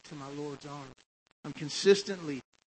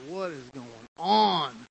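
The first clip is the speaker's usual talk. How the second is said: slow, exasperated question, a little louder; frustrated; 5 dB louder, much slower than usual. Retold or imitated. Stranded driver asking the Lord for answers, mildly frustrated. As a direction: drag out the question in mild exasperation.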